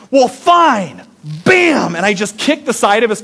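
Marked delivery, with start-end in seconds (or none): 0.1-1.0 higher, put-out huffy retort. Retold or imitated. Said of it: "and I just go". 1.4-2.0 mock kick bang. Kicking the car door in.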